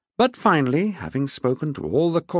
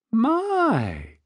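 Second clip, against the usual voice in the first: arch amusement; 12 semitones higher than usual. A narrator's reading. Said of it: "she replied in an arch but amused way".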